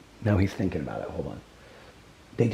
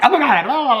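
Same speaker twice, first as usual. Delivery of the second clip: imitating her old frazzled self, higher and louder; a hammed-up flustered gush; 14 semitones higher, 4 dB louder than usual. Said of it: "I would have been like".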